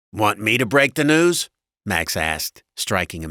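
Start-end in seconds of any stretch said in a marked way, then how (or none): 0.1-1.5 loud, raised husband voice; brash husband, pitched up; blunt husband. A narrator's reading. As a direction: offer it loudly and bluntly.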